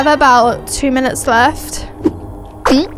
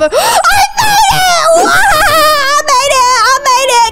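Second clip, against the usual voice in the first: triumphant shriek; 8 semitones higher than usual. Real, not acted. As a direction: scream with joy at escaping. Escapes at the last second.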